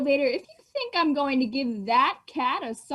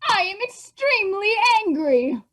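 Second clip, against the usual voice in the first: higher, louder angry mother; 7 semitones higher, 5 dB louder than usual. Acted. Mother finding the saucer in the cellar, angry. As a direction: declare the anger like a cross parent.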